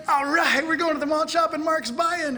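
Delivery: high, delighted cheer with a big smile; mock glee. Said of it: "you get a big smile on your face".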